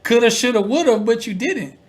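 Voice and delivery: high, teasing sing-song; mocking taunt. Mocking [name]'s excuse.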